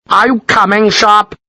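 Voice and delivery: high, pressing officer's challenge; ultimatum. A narrator's reading. Gibbons issuing Sharp an ultimatum, high and pressing. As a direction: dare him to refuse. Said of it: "made it sound like an ultimatum".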